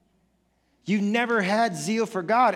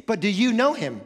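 ordinary talk, nothing marked

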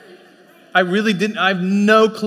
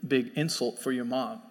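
dad gently explaining; 7 semitones lower, 6 dB quieter than usual. Retold or imitated. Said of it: "he's like".

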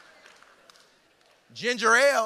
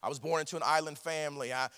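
self-doubting excuses; 7 semitones lower than usual. Acted. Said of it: "Many of you are like Jeremiah".

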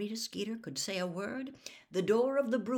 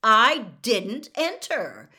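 slow, much louder insistence; irritated; 3 semitones higher, 13 dB louder, much slower than usual. Acted. Harry, irritated by the reporter, repeats his denial loud and slow. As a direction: stamp out each word, fed up. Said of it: "starting to feel irritated".

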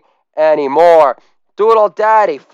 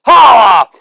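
loud high "Holla!"; shouted holla; 6 semitones higher, 7 dB louder than usual. Acted. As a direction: yell it to the crowd. Shouted catchphrase.

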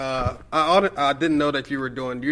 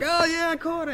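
much higher, a lightly voiced young fan; mock excited kid self. Fan recalls waking early to watch the show, high and chirpy. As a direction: toss it off like a kid who just caught the show.

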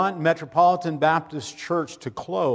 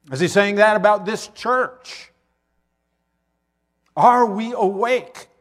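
loud rising appeal; 7 semitones higher, 8 dB louder than usual. Real, not acted. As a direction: press the question home. Rising sermon climax.

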